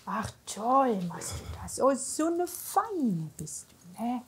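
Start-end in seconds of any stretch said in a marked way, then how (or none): none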